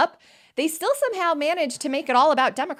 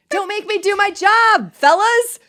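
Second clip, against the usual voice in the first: mock senator; 9 semitones higher, 5 dB louder than usual.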